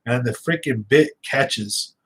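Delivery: ordinary talk, nothing marked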